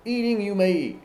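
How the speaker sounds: "Eating you may eat" pitched high and emphatic; high, insistent "Eating", falling on the last "eat"; emphatic doubling. Shown as a demonstration. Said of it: "that gives the emphasis".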